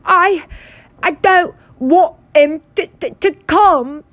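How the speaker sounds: high, loud fake-sobbing boy; yelling through pretend sobs. A narrator's reading. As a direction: wail haltingly with hammed-up sobs. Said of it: "Dudley yelled between huge pretend sobs".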